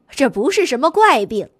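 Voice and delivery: usual speaking voice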